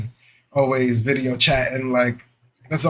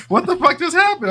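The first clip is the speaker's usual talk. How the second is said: incredulous. Retold or imitated. Retold reaction to stranger answering.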